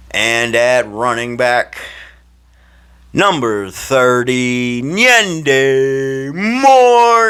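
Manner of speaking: loud, high, stretched-out player introduction; stadium announcer. Retold or imitated. Announcer calling the running back onto the field, booming build-up. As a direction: draw out every word, building to the name. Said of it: "They were like".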